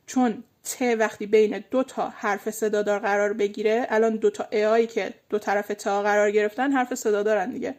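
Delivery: usual speaking voice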